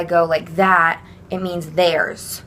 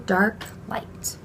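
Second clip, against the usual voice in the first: soft whispered pair; whispering the contrast; 6 dB quieter than usual. Shown as a demonstration. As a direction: breathe both words.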